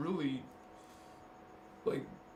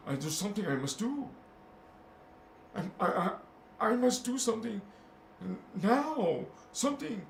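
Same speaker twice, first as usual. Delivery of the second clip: higher-pitched, insistent inner voice; acted urgency; 8 semitones higher than usual. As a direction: play someone driven by a pressing mission. Voicing an indigo's urgent inner drive.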